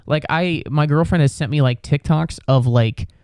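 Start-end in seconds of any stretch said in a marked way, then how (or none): none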